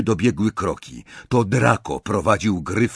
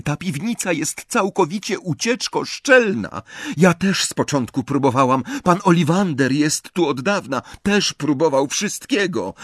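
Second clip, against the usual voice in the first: put-on high-pitched girl's explaining voice; Luna; 6 semitones higher than usual. A narrator's reading. Luna explains the escape-proof cellar to Ron, high girlish voice.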